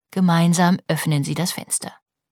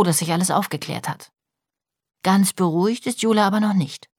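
usual speaking voice

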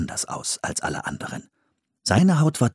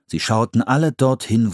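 ordinary delivery, nothing marked

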